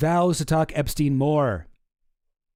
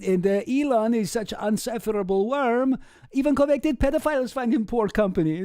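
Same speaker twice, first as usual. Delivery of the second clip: mock Russian accent; 8 semitones higher than usual. Shown as a demonstration. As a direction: read it like a Russian joke told in a Russian accent. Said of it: "it's like a Russian joke".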